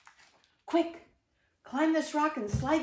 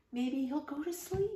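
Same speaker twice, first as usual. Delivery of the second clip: ordinary delivery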